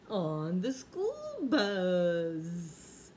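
gasped mock surprise. Riddle punchline to grandkids.

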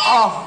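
sudden sharp "आह!" at the squeal; jolted by the noise; startled cry. Real, not acted. Feedback squeal from the radio. (अचानक चौंककर निकली "आह!")

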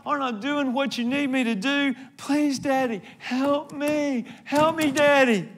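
high, pleading cry to a father; desperate pleading. Acted. Jesus begging his Father in the garden, high desperate plea. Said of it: "He was screaming, calling out to God".

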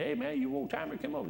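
put-on high squeaky voice teasing an old man; mock old timer. Preacher mocking old folks, high squeaky old-timer voice.

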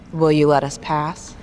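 ordinary talk, nothing marked

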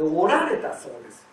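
おられた with ら pushed from the throat; stressed ら. Shown as a demonstration. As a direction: press the ら in おられた. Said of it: "どっか力入ってますね".

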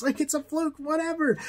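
high, breezy shrugging tone; mock dismissive. Remembering his old shrugging self, mock dismissive. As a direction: toss it off lightly, a mild mock shrug. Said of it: "I thought, you know".

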